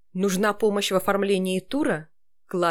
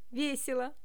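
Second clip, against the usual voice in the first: bright cheerful reading; 5 semitones higher, 8 dB quieter than usual. Shown as a demonstration. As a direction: read it happily. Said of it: "Я могу читать".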